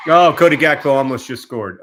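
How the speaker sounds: sudden loud burst at the near goal; excited. Real, not acted. Watching the game, he yells at the near goal, excited. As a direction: blurt it out, thrilled. Reacting to the live match.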